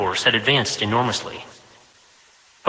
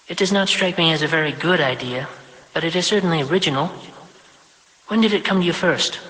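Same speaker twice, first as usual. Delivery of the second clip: higher, prim voice of a self-important mother; higher, brisk mother granting her son five minutes; busy mother, briskly obliging; 5 semitones higher than usual. Acted. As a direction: fit your son into a tight schedule, higher. Vashti judging his idea.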